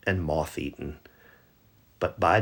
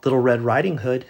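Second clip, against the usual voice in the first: higher, louder, quick call across the house; mother calling out; 7 semitones higher, 7 dB louder, faster than usual. Acted. Mother calling her daughter from the house, bright and loud.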